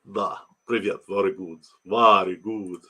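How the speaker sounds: low mock Russian accent; hammed-up Russian accent. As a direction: put on a Russian accent, savoring the words. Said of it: "Great Russian".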